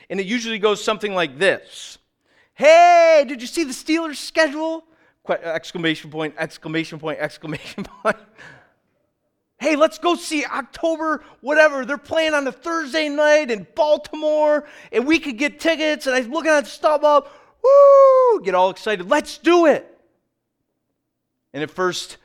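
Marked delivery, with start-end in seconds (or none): 2.5-4.8 high, loud gushing excitement; squealing Steelers fan hype; excited text. Retold or imitated. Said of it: "woo, get really excited about things". 9.6-20.0 high-pitched, loud fan gushing; excited fan. Retold or imitated. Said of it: "woo, get really excited about things".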